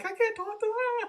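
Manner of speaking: high, strained little squeak; high squeaky voice. Retold or imitated. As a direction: squeak it out in panic. Retold own panicked reaction.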